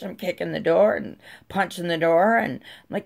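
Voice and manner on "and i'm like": ordinary delivery, nothing marked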